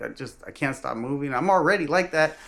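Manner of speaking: put-on tired kid complaining. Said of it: "I'm like".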